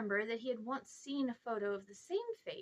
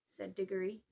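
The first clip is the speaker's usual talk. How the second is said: ordinary delivery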